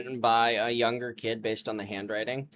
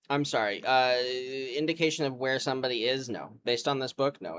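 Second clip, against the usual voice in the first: ordinary talk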